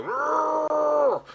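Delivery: high, drawn-out silly roar; mock cow roar. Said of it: "cows go".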